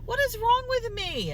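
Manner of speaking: high, loud mock wail at oneself; mock exasperation. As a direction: cry out in hammed-up despair at yourself. Mock self-exasperation at grogginess.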